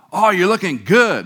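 lightly voiced doctor, bright and high; a hint of a chirpy physician handing out praise; cheerful doctor. Doctor praising his weight loss.

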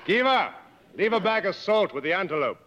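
shouted order to a tracker some distance away; calling out. Acted. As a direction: raise the voice to reach a man far off.

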